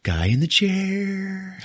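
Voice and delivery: high, slow, savoring each word; a hammed-up triumphant declaration; proud announcement. Ned spinning round to claim his role, high and drawn-out.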